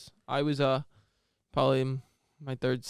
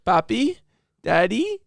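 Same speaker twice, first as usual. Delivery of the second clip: higher, louder coaxing call; mock pleading; 6 semitones higher, 6 dB louder, much slower than usual. Friend begging him to wake up, pleading call. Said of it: "begging for him to wake up".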